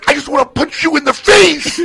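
very loud, high-pitched outburst; hammed-up yell of pure frustration; mock furious threat. Host imitating the woman threatening the co-worker, mock furious.

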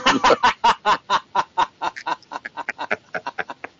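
high laugh tumbling downward; long burst of laughter. Real, not acted. Friends laugh at teasing a co-host, long falling laughter. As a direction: laugh on and on, gradually running down.

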